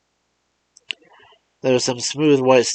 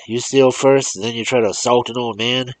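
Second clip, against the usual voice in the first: loud, raised scolding; angry old man; 6 semitones higher, 7 dB louder than usual. Acted. Old man scolding the boy with the rock, angrily loud. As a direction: bluster at the boy.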